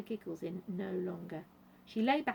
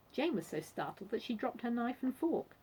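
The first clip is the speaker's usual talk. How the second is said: ordinary delivery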